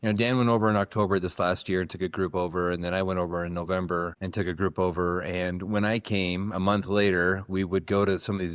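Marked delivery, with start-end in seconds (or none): none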